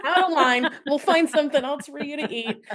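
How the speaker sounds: high, laughing mock push along; hurrying boyfriend. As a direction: hustle her down the line while laughing. Boyfriend voiced continuing.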